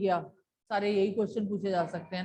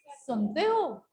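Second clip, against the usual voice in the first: 1 calling out; 4 semitones higher, slower than usual. Shown as a demonstration. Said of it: "क्या बोल रहा है वो?".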